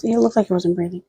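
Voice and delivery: normal talking voice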